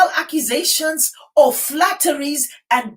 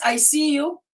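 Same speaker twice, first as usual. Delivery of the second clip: normal talking voice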